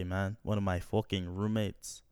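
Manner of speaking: normal talking voice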